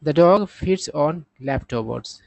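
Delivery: very slow, word by word English; slow example sentence. Shown as a demonstration. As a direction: read it out slowly for learners. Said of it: "क्या कर रहा है".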